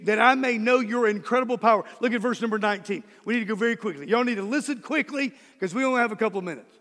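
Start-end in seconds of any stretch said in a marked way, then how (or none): 0.0-1.8 fast, run-together restatement; fast throwaway restatement; quick repeat. Shown as a demonstration. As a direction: rattle it off. Same prayer line repeated quickly.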